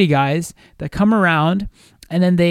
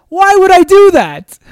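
very high, loud and slow disbelief; retold incredulous anger; 14 semitones higher, 10 dB louder than usual. Boyfriend to girlfriend, loud and incredulous. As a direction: stretch it out in outraged disbelief. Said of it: "I was, like, so pissed".

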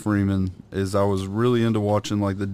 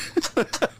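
high giggle. Real, not acted. Laughing at the Dumpy voice.

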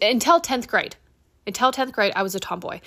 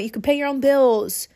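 usual speaking voice

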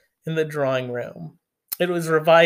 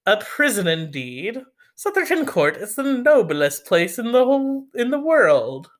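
put-on indignant old aunt; 7 semitones higher than usual. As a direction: rise up in outrage defending Sotherton. Mrs Norris indignant.

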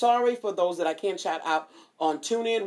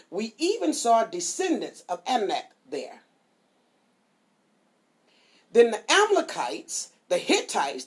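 louder, measured Bible reading; scripture reading; 3 semitones higher, 5 dB louder than usual. Acted. Preacher reading Numbers to listeners, proclaiming loudly. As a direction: proclaim the passage to the flock.

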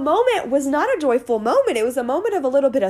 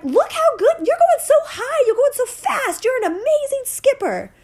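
put-on squealing high kid praise; excited child's voice; 13 semitones higher than usual. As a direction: imitate your daughter raving. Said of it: "she's like".

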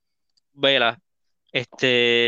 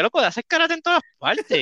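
exasperated; 13 semitones higher than usual. Retold or imitated. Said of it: "Es como que".

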